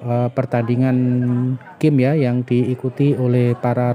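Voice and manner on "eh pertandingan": usual speaking voice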